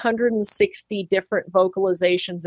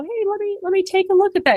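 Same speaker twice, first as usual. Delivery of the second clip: gentle soothing; 11 semitones higher, faster than usual. Retold or imitated. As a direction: soothe a hurt toddler kindly. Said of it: "walk up to them nicely and go".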